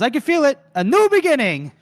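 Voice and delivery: high, loud, beaming delivery; smiling excitement. Retold or imitated. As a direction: burst out with giddy hope. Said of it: "and he's smiling".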